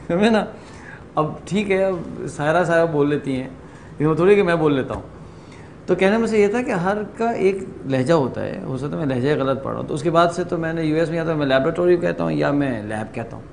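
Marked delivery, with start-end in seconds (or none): none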